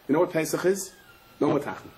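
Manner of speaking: ordinary delivery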